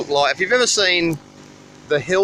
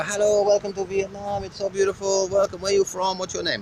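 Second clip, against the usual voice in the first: lightly imitating a cheerful local, pitched higher; mock-friendly roadside hello, sing-song and higher; friendly local greeting; 6 semitones higher, 3 dB quieter than usual. Locals riding up beside him on the highway, higher cheerful greeting. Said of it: "and they go".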